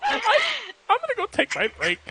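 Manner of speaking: high, hurried hurt voice; wounded exit line. Acted. Heartbroken cook fleeing to his break, high hurt blurt. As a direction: blurt it and escape.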